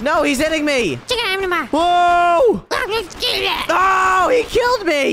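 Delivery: very high, loud wail; panicked screaming. Real, not acted. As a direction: scream out in dismay as you get killed. Hit and killed in game.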